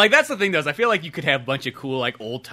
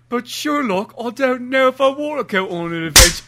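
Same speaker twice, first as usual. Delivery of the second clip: higher-pitched, put-on whining sidekick voice; reluctant Watson; 6 semitones higher, 6 dB louder than usual. Watson balking at Sherlock's adventure, reluctant whine. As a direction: protest weakly in a high sidekick voice. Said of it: "And then Watson shows up".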